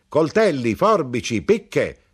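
high, loud street cry; grinder's cry. A narrator's reading. The knife grinder hawks his trade, loud street cry. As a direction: cry out the wares like a peddler. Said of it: "gridò l'arrotino".

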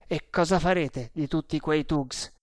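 usual speaking voice

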